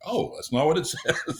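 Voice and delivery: imitating a startled reader, laughing at the end; surprised narrator. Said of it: "they're like".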